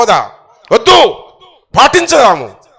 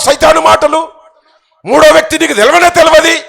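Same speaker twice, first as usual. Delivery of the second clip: ordinary talk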